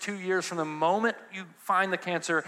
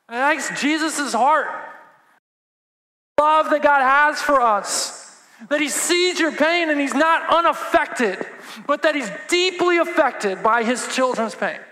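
high, loud, slow preacher's cry; preaching at full force; 9 semitones higher, 7 dB louder than usual. Acted. Pastor to congregation, loud slow preaching cry. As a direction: lean into it like a grieving father.

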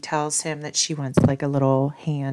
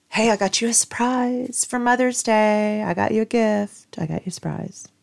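higher-pitched sing-song imitation of him; mock husband voice, lilting and high; husband announcing a surprise; 6 semitones higher than usual.

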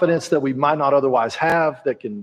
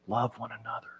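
soft, faint, much quieter; old man's whisper; 4 semitones lower, 15 dB quieter than usual. Retold or imitated. Preacher voicing old John's faint whisper. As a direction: barely breathe it like a dying elder. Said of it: "all he could do was whisper".

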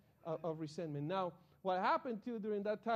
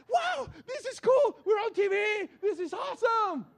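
put-on thrilled teen; 14 semitones higher, 14 dB louder than usual. To his sister watching the news, a high thrilled shout. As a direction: shout it like a kid who just saw himself on TV. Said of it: "I look at my sister".

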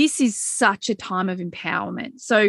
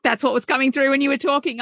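usual speaking voice

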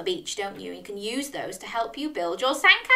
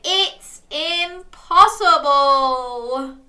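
slow, high and louder; drawn-out exclamation; 5 semitones higher, 6 dB louder, much slower than usual. Acted. Storyteller gets children to say Dog's line, drawn out. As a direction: stretch it out for children to copy. Leading children to echo Dog.

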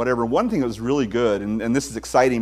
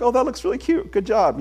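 much higher put-on praise; mock enthusiastic parent; 8 semitones higher than usual. A dad praising his child's drawing, mock enthusiastic. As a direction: gush it like a distracted parent humoring the kid. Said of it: "you look over and like".

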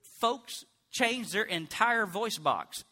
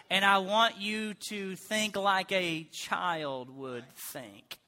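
slow, spaced-out words; measured, patient voice of God; patient instruction; slower than usual. Acted. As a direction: spell it out slowly, softening at the end. Acting God's patient instruction to him.

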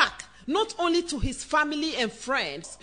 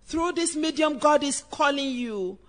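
usual speaking voice

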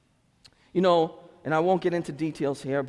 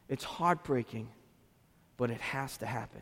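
soft heartbreak; 4 semitones lower, 8 dB quieter than usual. Real, not acted. Pastor confessing heartbreak over family boundaries, quietly.

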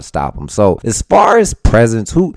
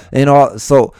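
normal talking voice

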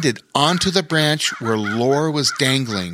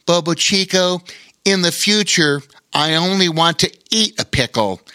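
put-on somewhat higher child character voice; lightly voiced boy; 7 semitones higher, 4 dB louder than usual. A narrator's reading. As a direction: lightly play a boy making his point. Boy teases otter after dinner.

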